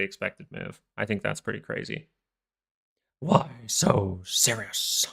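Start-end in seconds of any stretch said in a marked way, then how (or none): 3.2-5.1 slow, drawn-out villain catchphrase; mock Joker line. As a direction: lightly play the comic-book villain, very slowly. Movie villain catchphrase, Batman joke.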